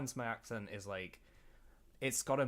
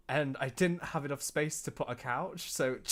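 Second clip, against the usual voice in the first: ordinary delivery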